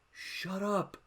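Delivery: high-pitched mock annoyance; mock exasperated outcry. Streamer voicing fed-up squad, mock exasperation. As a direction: plead for silence, high and put-on. Squad silencing the panicker, retold.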